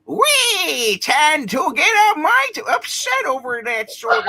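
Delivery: imitating a cartoon voice. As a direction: lay on a goofy cartoon voice. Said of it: "I just hear like this".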